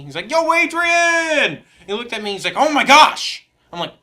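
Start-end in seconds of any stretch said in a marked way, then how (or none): 0.3-1.6 slow, stretched, much higher and louder yell; high, loud, slow hammed-up movie shout; Rocky. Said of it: "you know, Rocky". 2.6-3.5 high, loud alarmed gasp of words; a retold coach's loud, high shock. Coach sees his bloody face.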